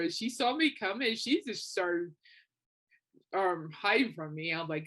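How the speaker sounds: ordinary talk, nothing marked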